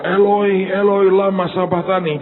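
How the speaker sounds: acted biblical cry; imitated cry of Jesus. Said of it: "Kalau Tuhan teriak".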